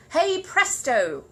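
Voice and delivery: very slow, loud, high hey presto; magic flourish. Acted. Paddington revealing the trick to the guests, triumphant. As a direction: draw it out with a flourish.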